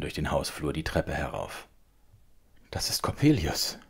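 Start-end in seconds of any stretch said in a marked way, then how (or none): 2.7-3.8 half-whispered alarm, jumping up on the name; paling with dread. A narrator's reading. Mother hearing heavy steps on the stairs, hushed dread. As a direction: gasp it out in hushed fear. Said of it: "sagte meine Mutter erblassend".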